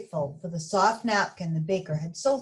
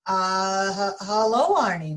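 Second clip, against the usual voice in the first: a touch of nervous stammer, louder; 5 dB louder than usual. Acted. Mister Bing stammers a nervous hello to Arnie, mild stammer. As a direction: stumble over the greeting. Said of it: "Mister Bing stammered".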